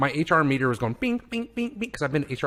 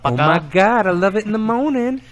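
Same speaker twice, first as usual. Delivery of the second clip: excited; 7 semitones higher, 8 dB louder than usual. Real, not acted. Excited praise of bacon.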